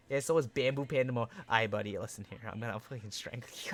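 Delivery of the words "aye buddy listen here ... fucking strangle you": soft, fast mock threat; low menacing joke; quiet mock threat. Real, not acted.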